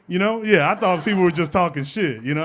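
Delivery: ordinary talk, nothing marked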